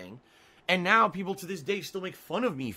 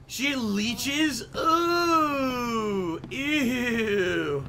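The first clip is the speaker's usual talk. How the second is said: higher, grossed-out cries; disgusted squeal; 6 semitones higher, 5 dB louder, much slower than usual. Real, not acted. Streamer reacting to a leech enemy, squealing in disgust. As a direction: recoil in disgust, voice jumping up.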